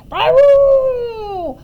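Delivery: high, loud, long trumpeting baroo; big blast of storybook elephant call. A narrator's reading. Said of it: "he sucked in some air".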